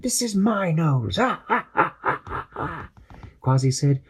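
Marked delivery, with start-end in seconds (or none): 0.0-2.9 nose germ gloating over the heroes. Acted. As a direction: gloat and cackle like a villain.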